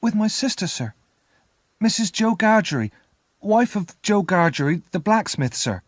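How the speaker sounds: put-on high-pitched, eager-to-answer child; nervous boy. A narrator's reading. Boy tells the convict about his sister, nervous.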